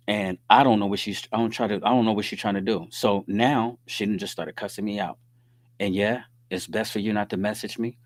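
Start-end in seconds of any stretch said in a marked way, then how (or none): none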